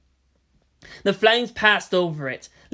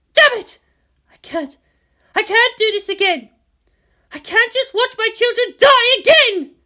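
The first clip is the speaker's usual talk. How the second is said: much higher, louder despairing cry; anguished outburst; 8 dB louder than usual. Acted. Commander despairing over her children, anguished cry.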